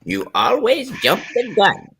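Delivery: put-on high-pitched old granny scolding. Teasing friend for smoking early, old granny voice. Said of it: "do my old granny voice".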